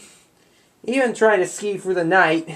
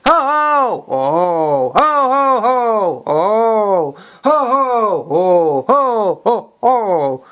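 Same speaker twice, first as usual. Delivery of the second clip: belly laugh; 3 semitones higher, 3 dB louder, much slower than usual. Retold or imitated. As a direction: laugh it out big and hearty. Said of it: "causes John and George to laugh".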